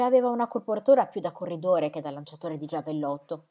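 ordinary delivery, nothing marked

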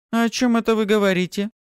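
high, loud, demanding question; indignant exclamation. A narrator's reading. Kastala refusing to be ignored, exclaiming. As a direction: cry out high, demanding attention. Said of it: "воскликнула Кастала, явно не желая, чтобы её игнорировали".

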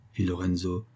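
plain reading voice

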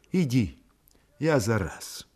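soft, drained, muffled voice; dull and lifeless. A narrator's reading. Sick woman sends her man indoors, dull and lifeless. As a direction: send him away with no life in the voice. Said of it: "Голос у неё был глухой и безжизненный".

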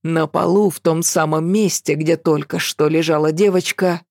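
usual speaking voice

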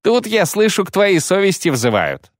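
high mocking lilt, smirking. Kayley mocking the matron to his comrade, smirking. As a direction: sneer it with a grin. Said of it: "насмешливо улыбаясь".

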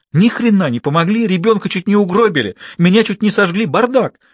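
high, loud furious rant. A narrator's reading. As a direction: pile up every grievance. Sister rants at surgeon.

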